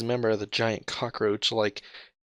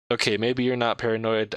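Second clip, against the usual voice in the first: normal talking voice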